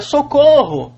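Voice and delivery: high shouted call for help; cry for help. Retold or imitated. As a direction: yell it like someone in danger. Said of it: "se alguém grita". (grito de socorro agudo e alto)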